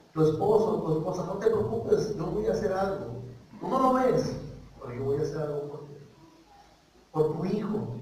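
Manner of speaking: soft, low voice of divine comfort; gentle, hushed consoling words; tender reassurance. Acted. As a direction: quietly promise help, as if one to one. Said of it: "a ti también te dice".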